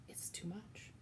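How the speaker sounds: whispered, broken off. Acted. Cook giving up in grief, whispered. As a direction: let it fall to a whisper.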